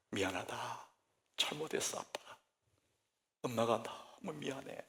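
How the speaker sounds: put-on soft, hushed apology to a child; a parent asking forgiveness through tears; tearful apology. Parent apologizes tearfully to child, soft and hushed. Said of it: "눈물로".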